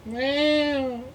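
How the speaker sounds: very high mock meow; cat meow. Vlogger meowing at his camera, high kitten meow.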